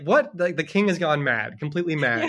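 high, loud startled yelp; the boy can't believe it; mock-startled what; baffled what. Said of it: "the boy is like".

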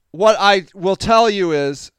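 ordinary talk

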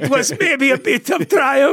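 high, mock Syrian accent on triumbh. Host mocking Trump's win in a Syrian accent. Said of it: "It's always triumph".

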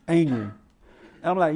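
ordinary talk, nothing marked

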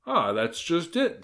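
high, drawn-out mild cry. A narrator's reading. Wolf seizes his chance to explain, crying out. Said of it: "cried the wolf".

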